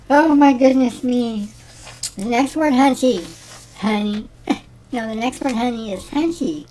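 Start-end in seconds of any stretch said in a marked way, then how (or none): none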